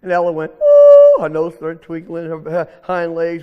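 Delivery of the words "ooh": very high, loud "ooh"; frightened squeal. Acted. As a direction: squeal like a scared little rabbit. Rabbit frightened of being eaten.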